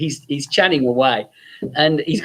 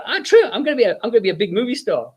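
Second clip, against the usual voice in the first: high and fast, bursting with confidence; eager boast; 7 semitones higher, faster than usual. Retold or imitated. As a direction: rush it out like a sure star. Jerry boasting to girls.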